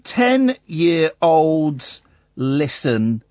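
very slow, word-by-word dictation; drawn out, pausing between words; writing aloud. Real, not acted. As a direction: say each word as you write it. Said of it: "I'm writing".

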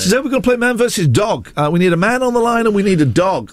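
hammed-up breakfast show patter; mock breakfast host. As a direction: parody a radio host announcing a silly game. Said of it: "I'm not one of those breakfast hosts".

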